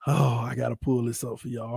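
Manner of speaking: ordinary delivery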